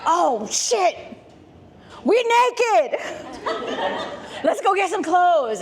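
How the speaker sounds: mock panic at being naked; Adam and Eve realizing they're naked, high and laughing; panicked Adam and Eve. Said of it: "they're like".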